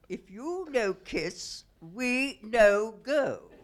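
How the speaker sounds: loud, slow broken-English Greek ultimatum; Greek fisherman. Retold or imitated. Fisherman refusing to take the women back: loud, slow Greek broken English. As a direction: lay down the terms like a stubborn Greek fisherman. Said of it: "he said".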